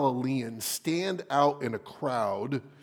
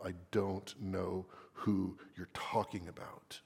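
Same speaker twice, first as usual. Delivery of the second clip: hushed, soft whisper of denial; 5 semitones lower, 8 dB quieter than usual. Acted. Peter denying Jesus to the crowd, whispered. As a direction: Peter hissing it under his breath.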